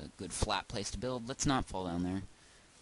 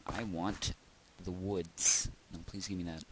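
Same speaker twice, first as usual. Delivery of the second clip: ordinary talk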